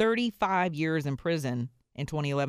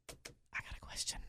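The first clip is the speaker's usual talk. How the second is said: hushed whisper to the president; 16 dB quieter than usual. Pretend. Whispering a question to the president at his table. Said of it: "I'd be like".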